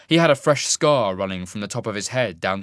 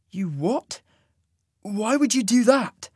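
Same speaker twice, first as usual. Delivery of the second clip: shocked; 11 semitones higher, 3 dB louder, slower than usual. A narrator's reading. Cole shocked.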